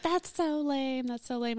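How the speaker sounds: high, softly drawn-out complaint; mocking complaint. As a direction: imitate let-down friends, dragging out the words. Acted quote of disappointed people.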